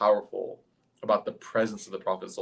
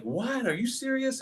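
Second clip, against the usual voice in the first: much higher-pitched disbelief; mock incredulous; 13 semitones higher than usual. Crowd hearing of the night journey, scoffing in disbelief. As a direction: react as a scoffing crowd member. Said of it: "people are laughing and they're joking".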